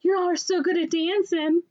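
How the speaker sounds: very high, gushing voice; mock admiring classmates. Class praises the arguing dancers, high mock gushing. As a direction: imitate onlookers through laughter.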